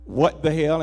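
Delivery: usual speaking voice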